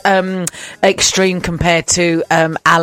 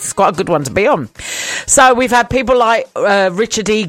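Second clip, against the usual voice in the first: ordinary talk, nothing marked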